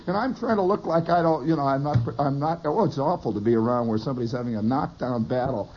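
ordinary delivery

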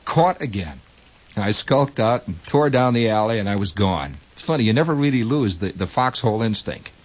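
ordinary talk, nothing marked

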